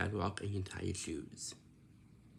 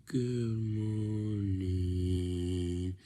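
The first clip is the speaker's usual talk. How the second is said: groggy just-woke-up voice; 2 semitones lower, 3 dB louder, much slower than usual. Shown as a demonstration. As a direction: drag it out like you just rolled out of bed. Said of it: "you sound like this".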